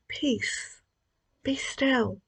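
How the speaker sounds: quiet, calm, falling phrase; recalling Jesus calming the storm, softly and calmly; soft and hushed. Acted. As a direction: say it gently, barely above a murmur. Retelling Jesus' words to storm.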